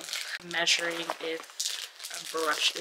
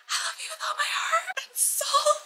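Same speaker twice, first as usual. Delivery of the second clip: tearful whisper. Real, not acted. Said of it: "I actually might cry".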